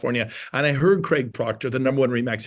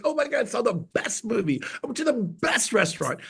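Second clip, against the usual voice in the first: higher, gushing over a great movie; gushing excitement; 7 semitones higher, 3 dB quieter than usual. Retold or imitated. A friend gushing to friends about a restaurant, excited. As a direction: rave about it like a thrilled friend. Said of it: "You gotta get excited".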